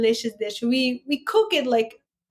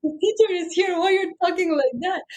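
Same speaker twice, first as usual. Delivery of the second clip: mock shocked, higher pitched; 5 semitones higher, faster than usual. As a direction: replay your shock at the rowdy class. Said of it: "I was like".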